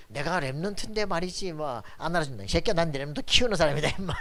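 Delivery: imitating a quarrel, building to loud, fast retort; mock indignant squabble. Preacher mimicking church members squabbling over status, mock indignant.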